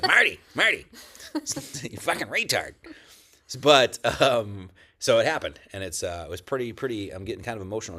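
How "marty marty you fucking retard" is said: mock scolding God. God answering his prayer, mock exasperated scolding. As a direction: play God fed up with him, scoffing. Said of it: "Some of it was like".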